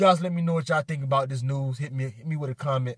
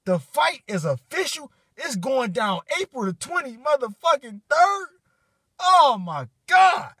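overjoyed announcement; 10 semitones higher, 5 dB louder than usual. Real, not acted. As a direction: break the news, then let it overwhelm you. Announcing fight date, overjoyed.

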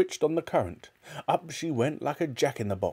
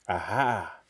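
plain reading voice

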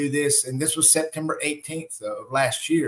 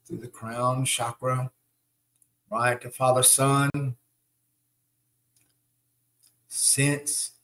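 guided meditation. Acted. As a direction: guide them through each breath, unhurried.

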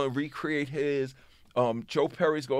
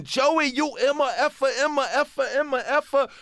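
high-pitched imitated tirade; mock screaming rant; 8 semitones higher, 3 dB louder than usual. Manager cursing out the rappers by phone, mock screaming. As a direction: rattle off censored curses in a raised, ranting voice. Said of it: "Russell screaming".

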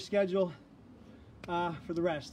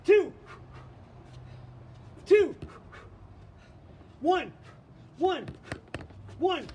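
shouted counts; 7 semitones higher, much slower than usual. Real, not acted. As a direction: call each count out hard. Coach shouting boxing counts.

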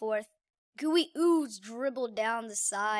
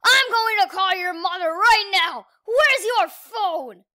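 loud, high furious yelling; furious shout; 6 semitones higher, 10 dB louder than usual. Acted. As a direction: scream at the kids. Quinn furious.

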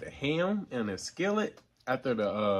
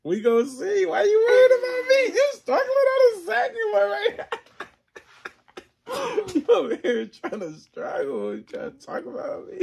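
high, loud, laughing taunt; laughing shout; 14 dB louder than usual. Real, not acted. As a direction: yell it through laughter. Teasing friend about eating more.

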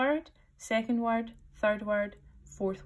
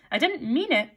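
high, louder plea; pleading; 6 semitones higher, 5 dB louder than usual. A narrator's reading. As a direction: plead in a raised voice. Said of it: "pleaded poor Alice".

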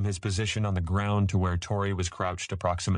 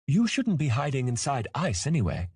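put-on high childlike voice; matter-of-fact young dragon; 8 semitones higher than usual. A narrator's reading. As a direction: reason like a sure little kid. Young dragon explains to elder.

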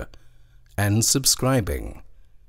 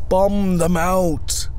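battle cry; 11 semitones higher, 6 dB louder, slower than usual. A narrator's reading. Battle cry quoted in poem.

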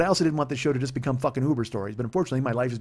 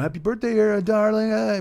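put-on higher, louder sloppy drunk crooning; drunk guy imitation; 9 semitones higher, 5 dB louder than usual. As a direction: ham up a drunk guy slurring a toast. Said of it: "then he's like".

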